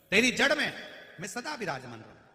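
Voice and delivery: Ganesh's fervent promise. Acted. Storyteller voicing Ganesh blessing Mandar, fervent loud promise. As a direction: cry out the divine promise. Ganesh voiced, emotional proclamation.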